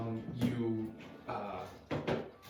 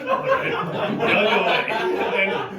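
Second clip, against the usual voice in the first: laughing; 9 semitones higher, 14 dB louder, much slower than usual. Retold or imitated. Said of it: "Then he kinda goes".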